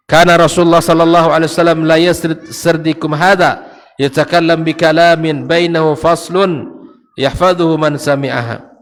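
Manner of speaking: preacher reciting scripture aloud; hadith recitation. Acted.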